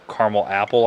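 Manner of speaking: caramel said as CAR-muhl before apple; pronunciation for candy. Shown as a demonstration. Reviewer giving an example, caramel as CAR-muhl. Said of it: "but I say caramel".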